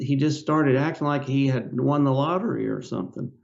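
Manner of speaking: ordinary delivery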